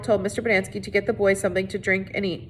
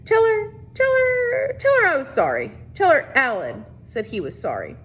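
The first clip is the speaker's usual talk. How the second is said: choked-up plea; 9 semitones higher than usual. A narrator's reading. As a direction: hold back tears for mom. Squid's message to mom.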